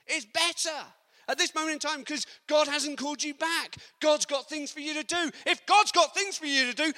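high, loud preacher's cry; preaching at full force. Acted. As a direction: build the congregation up with fervour. Preaching climax.

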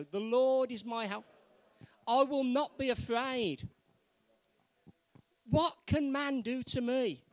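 loud and assured, line by line; confident declaration. Shown as a demonstration. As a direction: lead the room in saying it boldly. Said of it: "Say it with confidence".

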